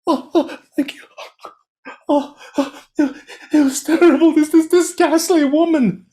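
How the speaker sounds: can't get the words out; panicked stammer. Acted. Terrified merchant stammers to the soba seller, high panicked.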